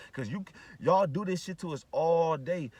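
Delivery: words drawn out one by one; drawn-out angry accusation; slow emphatic rant. Real, not acted. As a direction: spell it out slowly.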